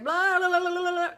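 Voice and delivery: mock head chatter. Describing the hurricane of thoughts, mock babble. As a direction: rattle it off like noisy thoughts.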